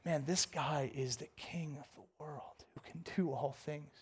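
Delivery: low, whispered wonder; soft, reverent realization; hushed awe. Acted. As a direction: drop to a near-whisper in awe. Awed reflection on God's power.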